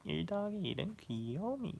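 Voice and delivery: very high, drawn-out cutesy voice; hammed-up babyish Korean cuteness; Korean aegyo. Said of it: "do some aegyo for you in Korean".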